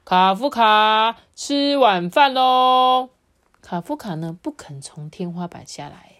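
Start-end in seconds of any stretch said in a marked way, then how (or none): 0.1-3.1 long, loud dad's dinner call; high, sustained calling-out; shouting. Acted. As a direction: holler through the door, stretching each syllable. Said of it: "爸爸在门外大喊说".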